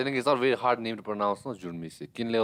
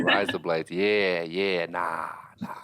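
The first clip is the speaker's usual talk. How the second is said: lower-pitched amateur Aussie accent; attempted Australian accent; 5 semitones lower, much slower than usual. Shown as a demonstration. Friend showing the only phrases known, lower mock Australian accent. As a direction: drop lower and try sounding Australian. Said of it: "cannot make an Australian accent".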